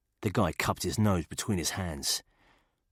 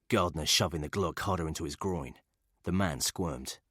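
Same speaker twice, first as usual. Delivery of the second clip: usual speaking voice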